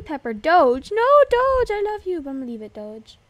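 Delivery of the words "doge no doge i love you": high, loud playful wail of devotion; mock-dramatic outcry. Player parting from beloved Doge, mock-dramatic outcry. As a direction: cry out as if losing a beloved.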